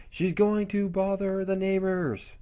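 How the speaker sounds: put-on high, slow tattling kid voice; complaining little brother. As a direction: tell on your sister, drawing it out. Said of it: "the brother goes".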